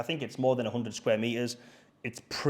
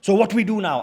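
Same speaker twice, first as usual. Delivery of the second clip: retold anger; 6 semitones higher, 6 dB louder than usual. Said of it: "very, very angry".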